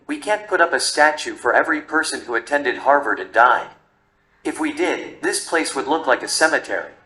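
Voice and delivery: plain reading voice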